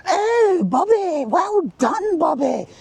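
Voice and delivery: very high, slow, cooing praise; drawn-out high-pitched mock praise; mock gushing parent. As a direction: ham up a parent fawning over the child. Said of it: "And they were like".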